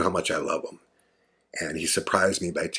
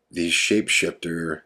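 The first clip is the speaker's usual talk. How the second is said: normal talking voice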